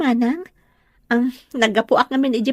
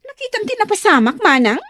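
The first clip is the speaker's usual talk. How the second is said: excited news; 9 semitones higher, 3 dB louder than usual. Acted. Sister bursts in with news.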